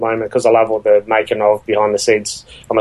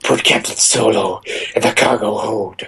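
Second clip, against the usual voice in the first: a lightly put-on movie-star voice, barely off his own; a hint of a film-star impersonation.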